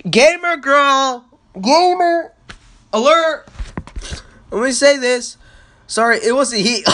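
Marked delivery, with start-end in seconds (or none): 0.1-3.5 mock gamer girl voice. Mocking teammate's girlish voice.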